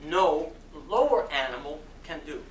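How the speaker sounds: ordinary talk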